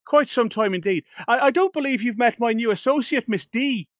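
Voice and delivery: lightly voiced theatrical performer being gracious; courteous stage magician. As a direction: lay on the courtly charm. Plays Adolfo, the stage magician.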